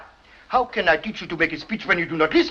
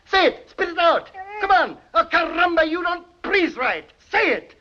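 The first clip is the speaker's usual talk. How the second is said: exasperated teacher; 10 semitones higher than usual. Acted. Teacher exasperated, accented drill.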